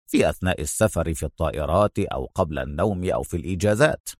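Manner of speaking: low, soft, casual listing; unhurried Adham. A narrator's reading. Adham continues while busy working, low and casual. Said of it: "وهو يستطرد".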